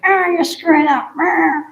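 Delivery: high nagging devil on the shoulder; little devil voice. Pretend. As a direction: needle the rider like a tiny devil. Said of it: "this one's over here, it's like".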